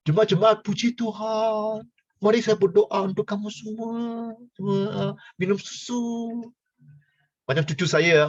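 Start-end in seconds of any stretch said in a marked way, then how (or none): 0.6-6.3 hammed-up holy voice blessing the milk drinkers; mock pious prayer. Mock preacher praying over them.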